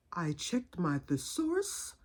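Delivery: slow, soft, precise aunt; Aunt Ada. Retold or imitated. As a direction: imitate a proper aunt reporting back deliberately. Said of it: "That's just how she talks".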